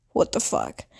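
whispered, stunned aside; low voiceless mutter of disbelief. Retold or imitated. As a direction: imitate speechless disbelief under the breath. Retold stunned reaction to mom.

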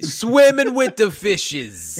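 high, loud, slow gangster line; a hammed-up gangster voice; mock mobster. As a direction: deliver it like a movie mob threat. Joining the mobster bit.

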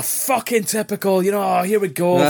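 high, exasperated fan complaint; mock-outraged crowd moaning; imitated crowd grumbling. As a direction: mimic disgusted fans shouting. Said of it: "people are shouting".

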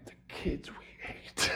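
slow, hushed whisper; very soft, drawn-out whisper; whispered aside. Pretend. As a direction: whisper it slowly, as a confidential aside. Whispered aside about the kids.

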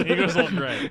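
giggling as you quote it back; amused, words broken by laughter; laughing. Real, not acted. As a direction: repeat the line through a real laugh. Laughing while echoing a note.

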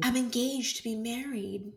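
soft, prim quoted apology; a hint of a polite refusal; impression of Sandra. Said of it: "Really good impressions".

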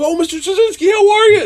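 host greeting his idol, high and gushing; fanboy gush. Retold or imitated. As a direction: gush like a starstruck fan. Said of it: "totally nerding out".

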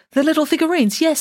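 high, eager princess voice; sweet curious royal girl; cartoon princess.

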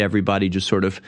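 normal talking voice

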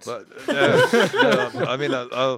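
high, loud stammer through laughter; laughing, tongue-tied protest; flustered laughing. Acted. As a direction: sputter objections while cracking up. Flustered reply amid laughter.

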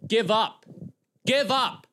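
high, exasperated mock yell. Vlogger yelling at the women to quit, mock yelling. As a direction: yell it high, in exaggerated frustration. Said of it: "Why are you yelling?".

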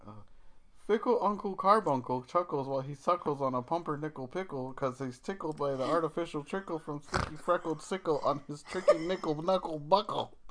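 ordinary talk

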